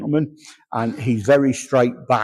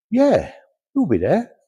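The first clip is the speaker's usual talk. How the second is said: breezy agreeing; 10 semitones higher than usual. Retold or imitated. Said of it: "You say".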